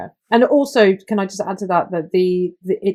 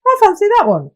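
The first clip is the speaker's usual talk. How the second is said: mock eager gamer; 14 semitones higher, 5 dB louder than usual. Retold player choosing.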